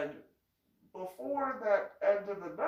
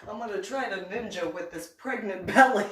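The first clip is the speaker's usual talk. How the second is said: talking through laughter; amused, laugh bubbling through; 5 dB louder than usual. Real, not acted. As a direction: crack up while saying it. Teller cracks up at own story.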